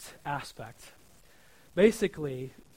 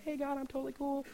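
a higher, softer breezy fake-casual voice; 9 semitones higher, 7 dB quieter than usual. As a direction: pretend everything is fine to God. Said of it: "pretend that I'm okay".

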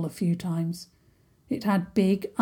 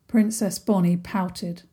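ordinary delivery

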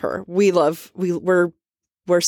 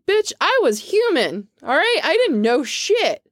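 high-pitched, slow indignant rant; indignant outburst; 9 semitones higher than usual. Acted.

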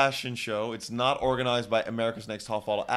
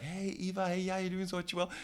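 mock Tyra greeting; 8 semitones higher, 6 dB quieter than usual. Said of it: "Tyra's there to be like".

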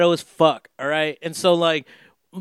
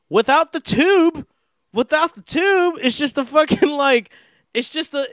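excited disbelief; 10 semitones higher than usual. Real, not acted. Retelling the mortar blast.

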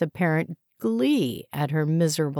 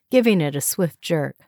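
plain reading voice

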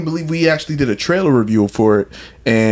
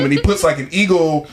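usual speaking voice

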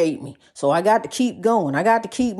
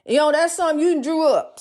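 high sassy preaching; 7 semitones higher than usual. Acted. High pitched mock plea and scolding.

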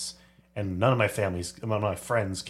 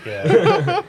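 high chipmunk voice effect; 13 dB louder than usual. Shown as a demonstration. Sound effect pitch shift.

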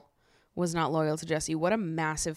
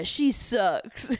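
mock Will; 5 semitones higher than usual. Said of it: "And Will's like".

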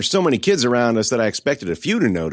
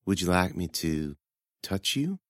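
softer, coy and teasing; soft seductive taunt; 3 semitones lower, 9 dB quieter than usual.

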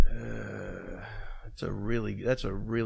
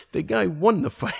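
high, louder words through laughter; laughing quote; 7 semitones higher, 5 dB louder than usual. Retold or imitated. Telling the dressing room story, laughing. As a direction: say it while cracking up.

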